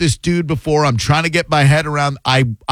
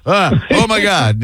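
acted gasp of horror, higher and drawn out; mock shock; 5 semitones higher than usual. Host acts out glancing at breastfeeding mom, mock shock. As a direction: play being caught looking, mildly appalled. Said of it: "your brain goes ... And then you go".

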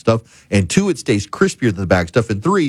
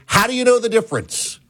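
higher mock professor's demand; a professor grilling a student, hammed up; challenging professor; 10 semitones higher than usual. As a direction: press him like a sceptical professor.

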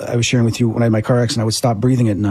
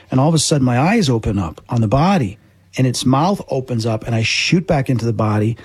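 usual speaking voice

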